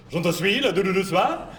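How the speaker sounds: high, quick mock-French question; French waiter babble. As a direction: rattle it at the tourist. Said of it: "He goes".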